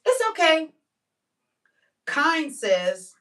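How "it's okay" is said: lightly voiced people-pleaser. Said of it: "But being nice".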